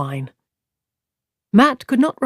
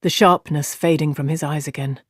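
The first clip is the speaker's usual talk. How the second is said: plain reading voice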